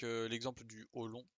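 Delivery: normal talking voice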